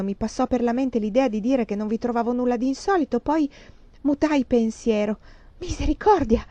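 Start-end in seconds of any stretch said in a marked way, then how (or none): 5.6-6.4 high cry of dismay; alarmed exclamation. A narrator's reading. Woman reacts to the bitter coffee, alarmed exclamation. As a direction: exclaim in shock.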